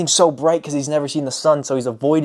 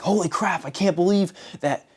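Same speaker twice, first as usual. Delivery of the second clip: higher, excited disbelief; bursting with the discovery, pitched up; amazed; 7 semitones higher than usual. Retold or imitated. Freed prisoner telling of the light, high excited amazement. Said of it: "he's like".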